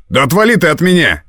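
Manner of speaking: high, irritated outburst; angry rebuff. A narrator's reading. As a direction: snap at her to back off, pitched high. Man angrily pushes girl away.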